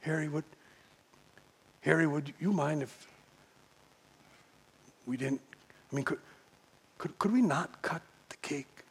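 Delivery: slow, hesitant. Retold or imitated. Agnes shyly asking the owner to keep her cake, hesitant and soft. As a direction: ask timidly, barely above a breath. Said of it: "slowly she starts to speak".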